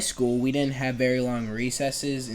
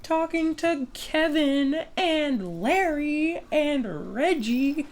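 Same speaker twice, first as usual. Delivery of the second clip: high mock teacher voice drawing out the names. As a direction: whine it like a nagging teacher. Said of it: "the teachers would be like".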